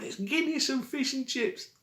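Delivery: high, choked disgusted wail; high squeal of disgust. Retold or imitated. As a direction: squeal while still smothered. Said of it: "I'm going".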